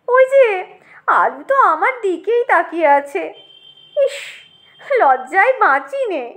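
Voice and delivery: high-pitched snobbish disgust; haughty tomato, appalled. Acted. Tomato ashamed to be seen with the brinjal, appalled. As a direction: shoo him away before anyone sees.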